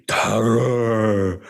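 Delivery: rolled R's in an imitated Tamil accent; Tamil English accent. Imitating the guru shouting out his word, Tamil English accent. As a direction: stretch out TERROR in mock Tamil English. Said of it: "with the rolling R's and the, you know, the Tamil English accent".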